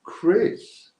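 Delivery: high, loud, drawn-out call of the name; excited announcement. Real, not acted. Host announcing the iPhone winner to viewers, excited rising call.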